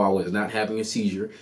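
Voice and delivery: ordinary talk, nothing marked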